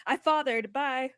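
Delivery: loud, careless brush-off; mock careless shout. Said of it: "He'd be like".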